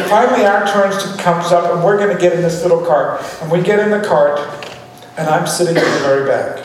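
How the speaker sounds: ordinary talk, nothing marked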